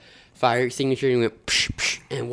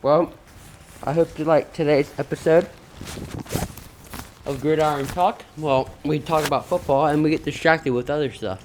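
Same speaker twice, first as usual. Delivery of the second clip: show host outro; 4 semitones higher, 5 dB louder than usual. Acted. Show sign-off.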